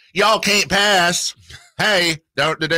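high, drawn-out Southern wizard; hammed-up Southern drawl, pitched high; Southern-accent Gandalf. Radio host imagines Gandalf as a Southerner, hammed-up Southern accent. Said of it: "if he'd had a Southern accent".

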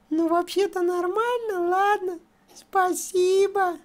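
ordinary talk, nothing marked

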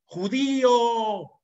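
drawn-out shouted slur, high and loud; retold yell. Stranger in a speeding van yells at the walker, drawn-out shout. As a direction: yell it from a passing car window. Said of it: "me grita".

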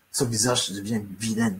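ordinary talk

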